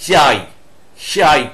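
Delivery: চাই said as the correct name of the letter; চাই pronounced like chai, not like কেটা; Greek letter name. Shown as a demonstration. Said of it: "এই কেটার উচ্চারণ হয় না".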